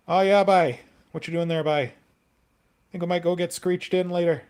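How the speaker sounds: loud, high-pitched Newfie accent impression; Newfoundland accent. Shown as a demonstration. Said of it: "I should practice my Newfie accent".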